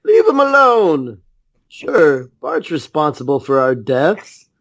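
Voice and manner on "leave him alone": high passenger voice. Pretend. Voicing a passenger.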